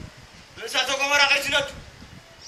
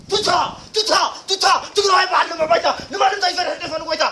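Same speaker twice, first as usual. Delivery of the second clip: rousing, loud war-cry chant; 2 semitones higher, 3 dB louder than usual. Acted. Heroic war chant performed in monologue. (ናይ ውግእ ፉከራ)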